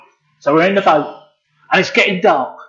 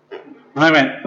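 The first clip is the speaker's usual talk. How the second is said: ordinary delivery, nothing marked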